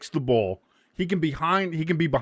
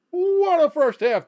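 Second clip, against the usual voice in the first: much higher, slow sportscaster call; hammed-up commentator; 13 semitones higher, 4 dB louder, slower than usual. Repeating the half-time call, higher hammed-up commentator. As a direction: lift it up like a TV host.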